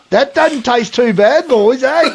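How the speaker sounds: high, slow and pleased with the prank; teasing mock relish. Prankster licks the Nutella before his mates, high teasing relish. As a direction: savour it to wind up the lads.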